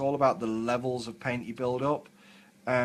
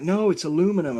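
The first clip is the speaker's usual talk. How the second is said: higher, insistent American "aluminum"; American girl; 6 semitones higher, 6 dB louder than usual. Retold or imitated. American workmate correcting him, mock American accent on "aluminum". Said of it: "she's like".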